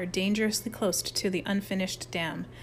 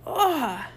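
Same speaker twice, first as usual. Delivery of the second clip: high frustrated cry; wordless cry of rage; frustrated scream; 6 semitones higher than usual. A narrator's reading. Harold storms out of the clearing, screaming.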